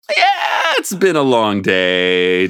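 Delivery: drawn-out mock yawn, slow; fake yawn. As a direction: yawn the words theatrically. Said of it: "doing his best to fake a yawn".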